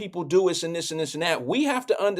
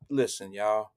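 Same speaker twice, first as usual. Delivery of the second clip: slow, soft, low plea to calm the women; soothing peacemaker; 10 semitones lower, 7 dB quieter, slower than usual. Retold or imitated. Walmart shopper calming the arguing women, soft and slow. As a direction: gently talk them down like a kind elder. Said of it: "I coulda just been like".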